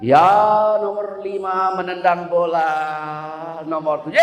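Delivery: drawn-out, dragging play-by-play; lazily stretched commentator's call; slow football commentary. Acted. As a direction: call the match in slow motion. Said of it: "Kalau dia ngomongnya cepek".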